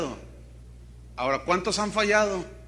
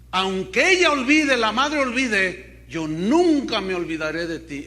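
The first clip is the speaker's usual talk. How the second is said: emphatic scripture proclamation; 2 semitones higher, 7 dB louder than usual. Acted. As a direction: drive the verse home with a big, loud opening. Repite el versículo con más fuerza. (proclamación enfática a plena voz)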